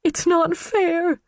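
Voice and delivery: high, slow, whimpering cry; pitiful breaking plea. Acted. Karen breaking down on the witness stand, whimpering. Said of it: "she whimpered".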